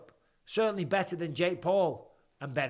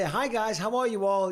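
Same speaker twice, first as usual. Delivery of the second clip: high, louder re-enacted chat greeting; eager greeting; 6 semitones higher, 4 dB louder than usual. Brother retelling his messages to the family group chat, eagerly. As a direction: act out hailing the group hopefully.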